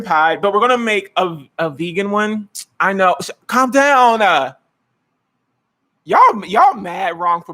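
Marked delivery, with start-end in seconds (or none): none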